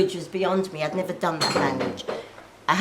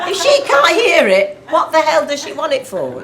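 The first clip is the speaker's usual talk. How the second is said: loud, high comic indignation; raised-voice incredulous complaint; exasperated outburst; 9 semitones higher, 10 dB louder than usual. Real, not acted. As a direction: throw it out to the crowd in exasperation. Exasperated punchline about the director.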